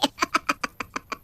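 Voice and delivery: a witch's gleeful evil cackle; high cackling witch laugh. Acted. Witch laughs after confession.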